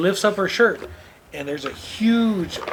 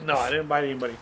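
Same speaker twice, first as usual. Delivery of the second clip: ordinary talk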